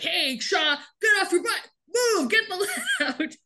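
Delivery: high-pitched mock yell, breaking into a laugh; hollering at a recruit on the ground; hammed-up drill sergeant yelling at Shaw; drill sergeant shout. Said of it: "the drill sergeant's like".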